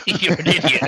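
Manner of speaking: laughing. Real, not acted. Repeating the joke line.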